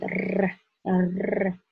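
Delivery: rolled r, tongue tip vibrating; Russian trilled r. Shown as a demonstration. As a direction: roll the r the Russian way. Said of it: "pronounce r in the Russian way".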